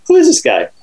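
high, wondering disbelief; amazed. Retold or imitated. Caddy amazed at his pro's shot, high and wondering. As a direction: marvel at him.

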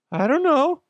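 high, squeaky little I don't know; squeaky patient. Retold or imitated. Patient plays innocent to doctor in squeaky high voice. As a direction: play dumb in a squeaky high voice. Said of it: "the guy's like".

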